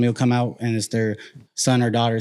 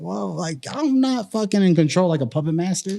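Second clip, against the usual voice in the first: mock retort; 7 semitones higher than usual. Retorting in imitated voice.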